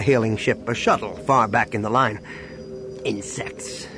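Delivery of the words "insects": hushed, low mutter to yourself. A narrator's reading. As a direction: mutter it under your breath. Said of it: "he muttered".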